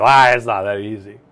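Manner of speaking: mock doubter. Coach voicing a skeptical viewer, grumbly. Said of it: "I heard you".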